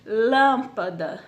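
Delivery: "Lâmpada" with the LÂM drawn out long and loud; stress on first syllable. Shown as a demonstration. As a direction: say "Lâmpada" slowly, leaning hard on LÂM. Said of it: "ele sobressai do restante da palavra".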